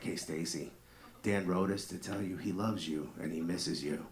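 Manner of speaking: low, soft love-dedication DJ voice; late-night radio DJ. Retold or imitated. Radio DJ reading a love letter to Stacy, hushed DJ voice. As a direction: croon it low and softly, like a Light FM host. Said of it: "the D J would come on and be like".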